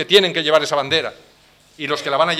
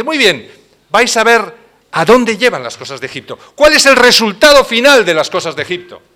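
loud and higher, a stern divine rebuke; God rebuking loudly; 5 semitones higher, 10 dB louder than usual. Acted. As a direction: thunder it down as God, louder and higher. God answers the people. (Dios reprendiendo en voz alta)

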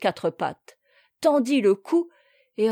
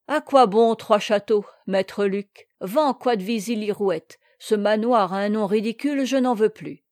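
usual speaking voice

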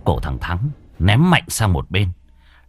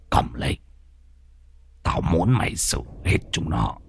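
put-on softer, cold old man's order; menacing old man; 2 semitones lower, 5 dB quieter than usual. Old Hỷ handing Thắng a knife, quiet and menacing. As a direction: give the order quietly, icy and cruel.

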